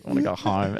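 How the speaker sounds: put-on low, slightly soft drunken plea; repeated whine. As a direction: say it again, a little fuller, still pleading.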